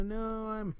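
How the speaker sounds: high, soft hesitant reply; acted sheepish hedging; awkward denial. Acts awkward reply to friend.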